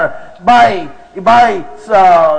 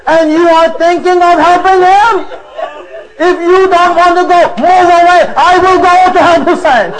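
enraged wife; 5 semitones higher than usual. Acted. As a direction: scold him, rising in fury. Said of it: "that enrages his wife".

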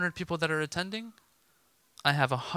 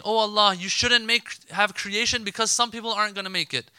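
higher, louder imitated protest to God; mock objection; 6 semitones higher, 5 dB louder than usual. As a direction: voice an objector arguing emphatically. Voicing an objector challenging God.

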